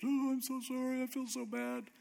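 high whiny fake-sorry voice; mock apology. Pastor mocking listeners who talk their way out with fake sorrow, high whiny voice. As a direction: pour on fake regret, hammed-up. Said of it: "gotten away with stuff by just".

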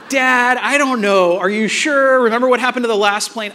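high, loud imitation of a doubtful kid; worried son. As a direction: imitate a boy pleading with dad to reconsider. Voicing his son's worried doubt.

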